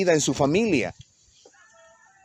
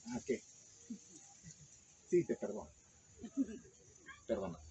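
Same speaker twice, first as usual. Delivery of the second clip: much softer, dismissive mumble; grudging forgiveness; 2 semitones higher, 22 dB quieter than usual. Retold or imitated. Someone brushing off a request for forgiveness, grudging and dismissive. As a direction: toss off a forgiveness you do not mean. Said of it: "hay gente que dice".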